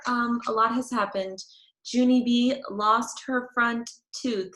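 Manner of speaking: ordinary delivery, nothing marked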